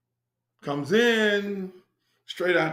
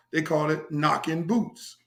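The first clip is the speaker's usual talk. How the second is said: usual speaking voice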